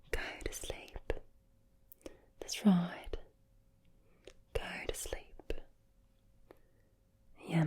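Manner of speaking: whispered Aussie accent, softening gradually; Australian accent. Shown as a demonstration. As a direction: lull a mate to sleep in hushed Australian. Accent showcase, Australian greeting.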